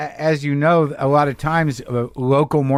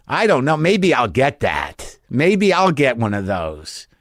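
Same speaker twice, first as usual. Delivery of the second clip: musing order; slower than usual. Retold or imitated.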